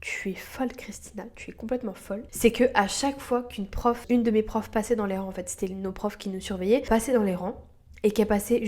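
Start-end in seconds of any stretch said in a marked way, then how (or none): 0.0-2.2 hushed whisper to herself. Retold or imitated. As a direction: whisper it, scolding yourself. Self-scolding quote, whispered.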